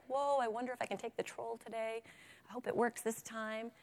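mildly tentative mock worry; wondering, unsure. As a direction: wonder aloud, half to yourself. Acting an unsure goat.

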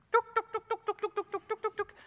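high, clipped tuk tuk tuk calls; mock bird shooing. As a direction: chase the birds off with high tuk sounds. Senior shooing birds.

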